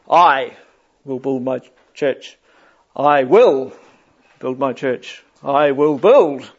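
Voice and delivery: हर शब्द पर ज़ोर, धीरे-धीरे; I will build my church with each word punched in turn; word-by-word emphasis. Retold or imitated. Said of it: "he just emphasised each little bit".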